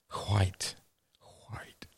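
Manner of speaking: whispered posh quite. Acted. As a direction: breathe it like a toff. Posh aside.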